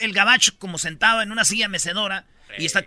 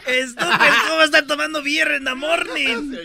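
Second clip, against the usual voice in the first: higher, louder, laughing through the words; laughing imitation; 7 semitones higher, 5 dB louder than usual. Host laughing repeats the mother's scolding. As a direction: copy the mom while cracking up. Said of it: "repeating the mother's line".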